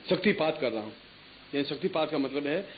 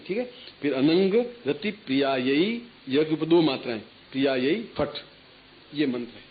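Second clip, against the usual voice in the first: usual speaking voice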